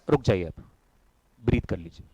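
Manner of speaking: low, soft instruction; calming pause. Real, not acted. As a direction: drop right down and let them breathe. Calming class after the chant.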